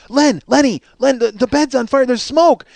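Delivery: high, loud, urgent warning; mother's alarm. Retold or imitated. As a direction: imitate a panicked wife rousing her husband. Mother alerting father to smoke.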